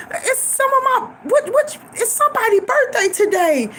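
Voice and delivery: high, lightly voiced mama; imitating mama. Mama wondering whose birthday it is, high-pitched voice. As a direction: pitch it up as mama searching her memory.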